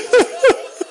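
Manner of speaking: sharp high sob. Real, not acted. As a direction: choke on a sob. Sob between lament lines.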